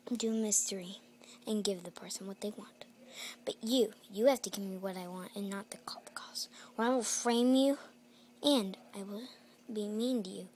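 threatening villain whisper; menacing whisper. Acted. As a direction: hiss the threat under your breath.

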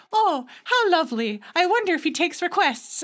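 a hint of a wry narrator commenting on the song. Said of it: "the narrator was just like".

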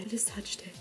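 soft whispered confession; awed whisper. Real, not acted. Whispering to viewers after touching the horse. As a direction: whisper it like it's sacred.

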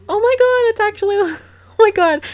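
loud, high-pitched burst of delight; excited squeal of surprise; real amazed excitement. Real, not acted. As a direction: exclaim in thrilled disbelief, breaking into a laugh. Amazed at seeing the frog.

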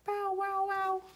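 bow-wow jumping up high; little dog bark. A narrator's reading. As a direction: bark the words like a small dog. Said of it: "Another little dog".

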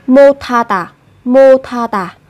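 "mô tha tà" with open "mô" and breathy th; linked aspirated th. Shown as a demonstration. Teacher shows the joined sound, linked "mô tha tà". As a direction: carry the stop into "tha" with a puff. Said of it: "Các bạn sẽ phát âm thành âm thờ".

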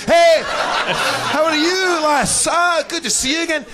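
loud, high, effusive Scottish greeting; fake Scottish accent. Faker greeting the woman at a bar, hammed-up Scottish accent. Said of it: "Oh, fuck, I'm Scottish".

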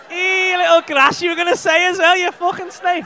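mock outrage. Comedian calls out an audience member as a grass, mock-outraged shout. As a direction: shout it as a playful telling-off.

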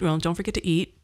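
ordinary delivery

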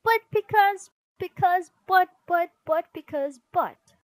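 high, loud stammered protests; rapid-fire squabbling interjections; sputtering excuses. Acted. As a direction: splutter over each other, getting nowhere. Siblings' excuses collapse into overlapping sputter.

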